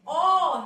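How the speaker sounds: ओ loud and held through round lips; round-lipped ओ. Shown as a demonstration. Teacher showing pupils the lip shape, round-lipped ओ. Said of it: "होंठ गोल गोल हो जाएंगे".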